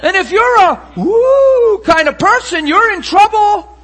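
very high, very loud preacher shout; shouted comic aside. Real, not acted. Preacher teasing the congregation about anointing, loud high holler. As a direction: holler it over the laughing crowd.

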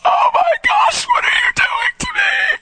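very high, crying squeal in imitation; overwhelmed squeal. Drive-in girl as their hands touch, overwhelmed wail.

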